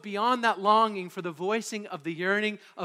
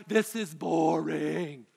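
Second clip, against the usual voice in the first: put-on bored kid; 4 semitones lower, much slower than usual. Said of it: "and I'm like".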